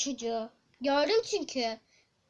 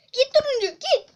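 loud, high yell at the orange; shouting; 8 semitones higher, 7 dB louder than usual. Real, not acted. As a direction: shoo him away loudly. Turuncu oyuncuya kaçmasını bağırıyor. (yüksek sesle bağırış)

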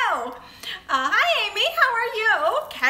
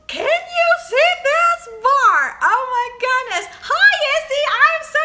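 loud, high, drawn-out excitement; excited; 9 semitones higher, 7 dB louder than usual. Real, not acted. Vlogger showing viewers a new candy bar, excited. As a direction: show it off loudly and slowly, thrilled.

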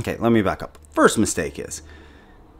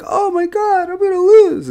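high-pitched squeal of mock dread; mock panic; 6 dB louder than usual. Gamer mimicking own reaction to losing, in high mock panic.

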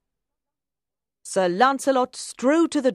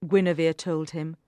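ordinary delivery

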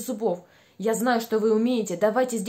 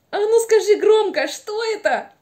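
excited urging; 8 semitones higher, 6 dB louder than usual. Acted. Urging the puppet to answer.

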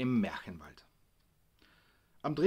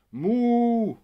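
shouted Muh, much higher and louder, like a cow; loud cow moo; 12 semitones higher, 14 dB louder than usual. Retold or imitated. Rascal showing off to his friends, loud mooing shout. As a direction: bellow a cheeky moo into the microphone. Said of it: "Er schnippte mit den Fingern und rief".

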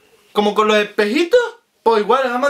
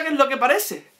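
normal talking voice

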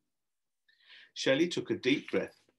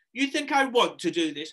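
high, loud indignant howl; raised, outraged question; 11 semitones higher, 6 dB louder than usual. Acted. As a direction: fire it back in exasperation. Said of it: "howled, howled Sherry".

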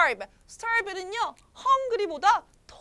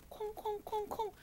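light, even thumping syllables; imitated footsteps; 4 semitones higher, 15 dB quieter than usual. Storyteller showing children someone coming, imitating footsteps. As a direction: tap out approaching footsteps with the voice. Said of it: "누군가 오는 발자국 소리".

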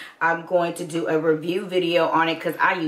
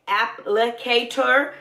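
ordinary delivery

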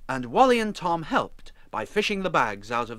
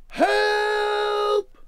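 very high, long booming holler; top of the voice; 6 dB louder than usual. A narrator's reading. Wally summons rescuers for Emma, top of the voice. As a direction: boom it out, stretching the word. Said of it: "at the top of his voice he boomed".

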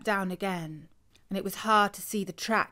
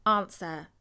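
ordinary delivery, nothing marked

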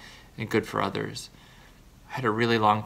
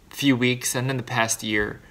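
usual speaking voice

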